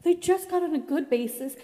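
upset complaint. Retold or imitated. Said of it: "I was just so mad".

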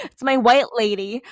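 usual speaking voice

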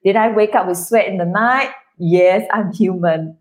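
ordinary talk, nothing marked

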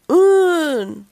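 loud, high drawn-out zombie cry; shouted zombie moan. A narrator's reading. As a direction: shout it as one long zombie syllable. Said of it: "shouted Zombie".

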